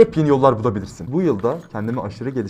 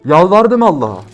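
louder, higher cry of begging God; emotional loud exclamation; loud plea; 3 semitones higher, 8 dB louder than usual. Real, not acted. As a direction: burst out with the memory of begging. Recalling grandfather's death, emotional.

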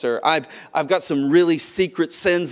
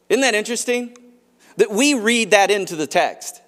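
ordinary talk

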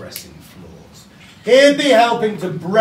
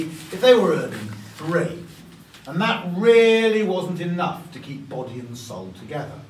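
usual speaking voice

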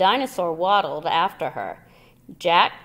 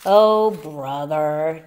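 exasperated mutter; 2 semitones lower, 7 dB louder, much slower than usual. A narrator's reading. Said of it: "Jack muttered".

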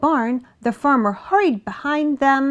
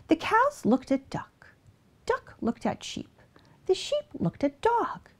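whispering; 7 semitones higher, 7 dB quieter than usual. A narrator's reading. Tense silent glances.

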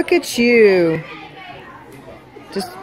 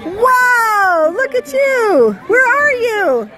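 excited cheering; 11 semitones higher, slower than usual. Real, not acted. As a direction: cheer him on with delight. Mother cheering her little boy.

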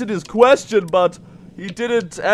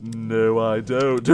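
low, soft mumble; quiet aside; 13 semitones lower, 9 dB quieter, slower than usual. Real, not acted. Player doubts wanting to fail friends, low quiet aside. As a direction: drop it down low to yourself. Muttered afterthought.

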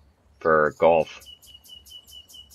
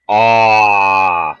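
loud disappointed aw; 3 semitones higher, 10 dB louder than usual. Real, not acted. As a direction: groan it out loudly, cheated by the bounce. Ball bounces away.

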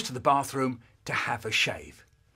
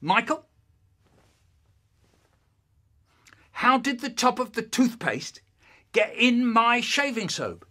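high, emphatic interrogation; accusing dad; 9 semitones higher, 4 dB louder than usual. Acted. Dad questioning son about the shaving soap, accusing. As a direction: question him pointedly, phrase by phrase. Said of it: "he goes".

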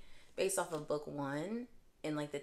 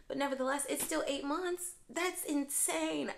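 wailing that the wait is insane, pitched way up; exasperated disbelief; 11 semitones higher than usual. Real, not acted. Complaining to viewers about the wait, exasperated and high.

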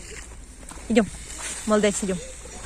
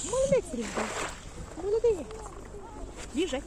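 talking to a dog; 6 semitones higher, 11 dB quieter than usual. Real, not acted. Training dog with treats.

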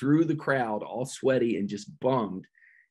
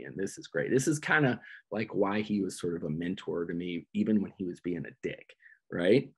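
ordinary talk, nothing marked

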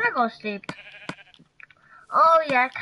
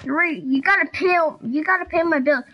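normal talking voice

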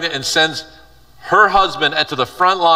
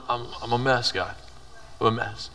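quiet confession; 3 semitones lower, 10 dB quieter than usual. Pretend. Imagined confession to God.

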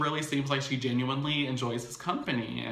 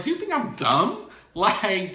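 much higher and louder disbelieving challenge; outraged; 9 semitones higher, 7 dB louder than usual. Retold or imitated. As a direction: fire it at him, insulted. Tierra confronting cheating Cisco.

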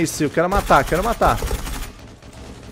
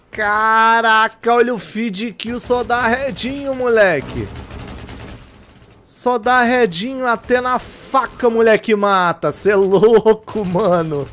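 excited hype; 5 semitones higher, slower than usual. Real, not acted. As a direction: gush over the kill feed. Excited over kill feed.

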